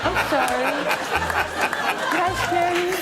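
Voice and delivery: soft Michael apology. Retold or imitated. Michael apologising to Chris in the cinema, high soft.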